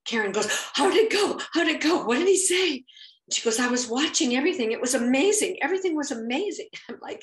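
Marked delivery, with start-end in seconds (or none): 0.7-2.9 high, loud, eager questions; almost shouting with excitement; excited shouting. Retold or imitated. Excited friend bursts with questions. 3.6-6.7 fast, high, loud rush of praise; gush, barely stopping for breath; rapid thrilled chatter; excited gushing. Retold or imitated. Friend gushing after watching.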